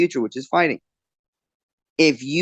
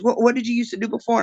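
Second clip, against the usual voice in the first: higher-pitched mock questioning; imitated puzzled customer; 8 semitones higher than usual. Bar customer trying to place the bartender's face, mock puzzled. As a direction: press for an answer as the retold curious customer.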